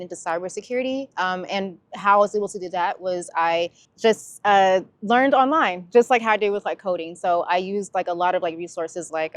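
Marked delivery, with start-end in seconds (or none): none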